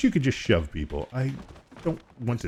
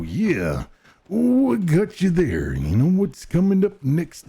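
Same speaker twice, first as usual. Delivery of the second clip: wrestler promo voice with big pitch swings; Macho Man; 6 semitones higher, 3 dB louder, slower than usual. Acted. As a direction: growl it like a showboating pro wrestler. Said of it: "wants to hear from the Macho Man".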